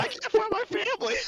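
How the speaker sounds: very high, loud whimpering wail; fake crying kid. Lost kid forcing tears for a stranger, high wailing sob. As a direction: put on a forced sob like a lost child. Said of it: "And I'm like".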